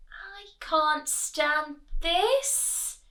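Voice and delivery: mounting storybook despair; exasperated bear. A narrator's reading. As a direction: stretch every word in dismay. Bear fed up, cannot sleep.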